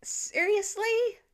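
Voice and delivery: high, slow, disbelieving "Seriously?"; incredulous demand. A narrator's reading. Teen brother demanding in disbelief at the score. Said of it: "Cooper demanded".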